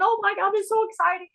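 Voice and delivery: high and fast, bubbling over; excited like a kid. Retold or imitated. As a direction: rattle it off like a thrilled child. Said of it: "I'm like this little kid going".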